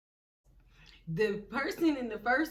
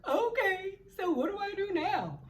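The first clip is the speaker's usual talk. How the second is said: high, whimpering helpless voice; mock tearful; 9 semitones higher, 3 dB louder than usual. Crybaby daughter asks mom for directions, mock tearful. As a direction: imitate a crybaby asking for help. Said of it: "I'm crying".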